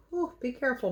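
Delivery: ordinary talk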